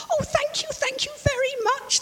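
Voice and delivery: very high, gushing thank-you; bubbly and playful. Acted. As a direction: bubble over with joy. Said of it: "the little fish bubbled playfully".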